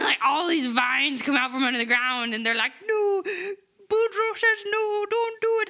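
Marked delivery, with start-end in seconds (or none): 2.9-5.7 high mock vine voice. Vines voiced pleading.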